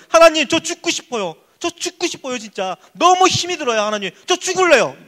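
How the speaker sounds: loud, high cry to God; desperate prayer. Acted. Young Joseph praying to God, desperate. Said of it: "끌려갔을 때부터 아마 기도했을 거예요".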